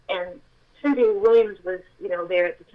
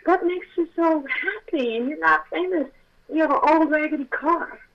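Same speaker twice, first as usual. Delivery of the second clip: astonished questioning; 7 semitones higher, 3 dB louder than usual. Retold or imitated. She acts out asking the angel.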